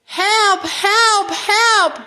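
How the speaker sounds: high, urgent little-truck cries; acted storybook distress; crying for help. A narrator's reading. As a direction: call for help in a small high voice. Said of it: "cried the little blue truck".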